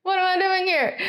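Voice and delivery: high-pitched mock bewilderment; imitating bewildered driver. George baffled to find himself third, high mock bewilderment. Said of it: "he was like".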